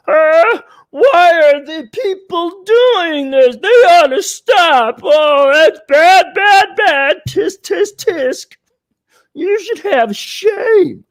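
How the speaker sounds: high, loud, slow mock wail; scolding old-timer. Old radio host scolding people today, high mock outrage. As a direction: scold like a scandalized old fart, drawn out. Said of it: "these old timers and these old farts".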